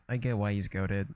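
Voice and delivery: normal talking voice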